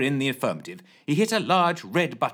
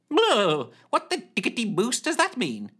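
high startled character voice; a high puzzled exclamation; Carl Shorten voice; 11 semitones higher than usual. A narrator's reading. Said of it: "she inquired".

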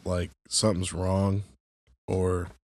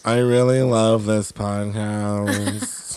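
mock enthusiastic guest; much slower than usual. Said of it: "enthusiastic interviewee yet".

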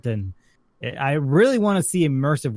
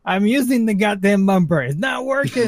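high, exasperated protest; frustrated; 7 semitones higher, 4 dB louder than usual. Retold or imitated. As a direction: snap back in exasperation. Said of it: "I'm like".

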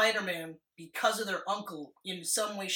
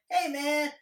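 higher, bright casual greeting; friends greeting; 6 semitones higher, 4 dB louder, slower than usual. Retold or imitated. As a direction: call out like old school friends. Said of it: "school friends are like".

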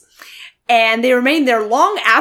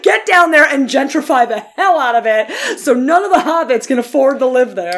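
loud mock order, raised pitch with laughter in it; sarcastic; 3 semitones higher, 4 dB louder than usual. Co-host riffs on gentrifying the hobbits' land, sarcastic. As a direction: play along sarcastically, half laughing. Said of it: "I can tell you're being sarcastic".

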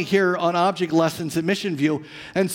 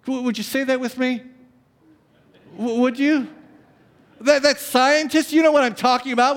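usual speaking voice

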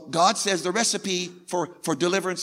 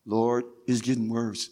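subdued complaint; 11 semitones lower, 7 dB quieter than usual. Acted. Believer repeats, now subdued.

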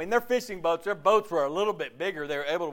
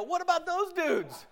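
much higher, laughing indignant complaint; mock indignant. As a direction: protest like a slighted disciple, half laughing. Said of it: "you know what I do? I go".

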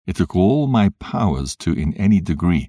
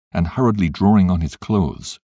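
ordinary delivery, nothing marked

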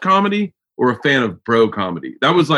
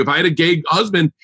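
ordinary talk

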